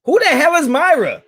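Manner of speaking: sudden high, loud disbelief; baffled. Real, not acted. Host baffled by a name he never said, loud disbelief. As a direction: blurt it out, totally lost.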